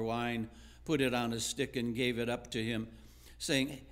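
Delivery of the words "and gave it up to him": ordinary talk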